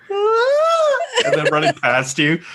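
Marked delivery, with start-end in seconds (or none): 0.1-1.0 very high panicked scream; fleeing scream. Retold or imitated. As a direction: shriek while running away. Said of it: "running back".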